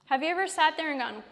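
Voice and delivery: ordinary talk, nothing marked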